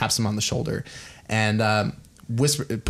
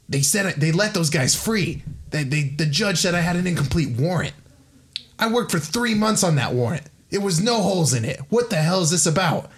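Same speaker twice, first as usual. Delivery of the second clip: higher-pitched, exasperated tirade at normal volume; acted indignant protest; 7 semitones higher than usual. Harvey vents to his backer over the warrant, indignant. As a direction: protest in mounting disbelief, pitch climbing.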